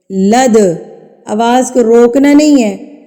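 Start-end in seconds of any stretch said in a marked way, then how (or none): 0.1-0.6 لد with the دال bounced off its point; qalqala bounce. Shown as a demonstration. Teacher demonstrates bouncing دال in لد. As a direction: hit the دال in لد and let it echo. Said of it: "لفظ کو مار کے پڑھنا ہے".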